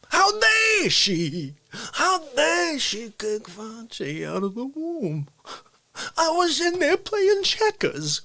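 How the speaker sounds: high, drawn-out outraged New York loudmouth; Dick Facce. Acted. Loudmouth character ranting about his mother, high strained wail. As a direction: stretch the words into wailing mock indignation. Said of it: "Facce's in my seat".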